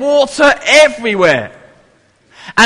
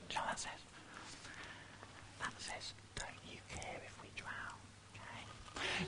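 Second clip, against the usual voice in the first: breathy whisper, barely audible; 35 dB quieter, faster than usual. Shown as a demonstration. Leader to the children, whispering the disciples' cry. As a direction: whisper it like a secret before the big shout. Reading sign before the shout.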